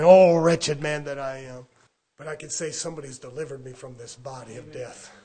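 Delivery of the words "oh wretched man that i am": loud, slow mock lament; wretched man. Preacher as the wretched man lamenting himself, loud groan. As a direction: wail it slowly in hammed-up misery. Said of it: "I could talk to you as a man".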